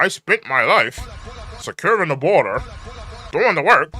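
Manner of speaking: lightly mimicked politician; mock her line a little; a hint of a campaign voice; mocking quote. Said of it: "talking about".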